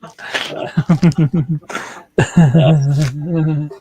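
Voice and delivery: long burst of real laughter; hearty laugh. Real, not acted. Listener hears the puppy joke, long hearty laugh. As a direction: laugh helplessly at the punchline.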